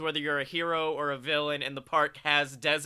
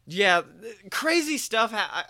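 ordinary delivery

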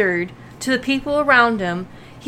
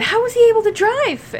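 much higher-pitched quoted question; imitating a nurse; 13 semitones higher than usual. Retelling the nurse's question about Michael driving, high-pitched mimicry. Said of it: "it was the nurse who even pointed out".

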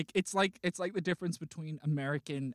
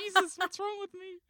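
very high-pitched Kiwi voice, laughing; squeaky mock New Zealand accent breaking into laughter; bad Kiwi accent. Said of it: "high-pitched".

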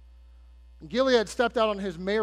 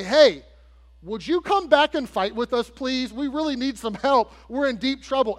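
higher, louder retold appeal for help; acted plea; 5 semitones higher, 6 dB louder than usual. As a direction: call out urgently, begging as the desperate party. Retold Ammonite-threatened Israelites pleading for help.

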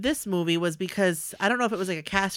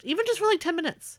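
excited; 10 semitones higher than usual. Real, not acted. Excited wish for guest.

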